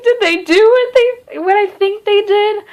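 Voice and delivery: shocked disbelief. Retold or imitated. Reader reacting to an implied love scene, stunned. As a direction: gasp it out as if you can't believe it. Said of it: "I was just like".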